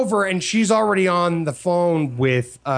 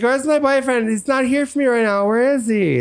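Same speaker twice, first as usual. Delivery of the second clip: put-on confused girl; 7 semitones higher than usual. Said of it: "She's like".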